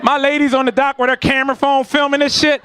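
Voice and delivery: ordinary delivery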